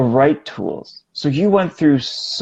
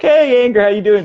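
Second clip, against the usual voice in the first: high, bright, louder hello to anger; friendly mock greeting; 9 semitones higher, 4 dB louder than usual. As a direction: greet it like an old classmate.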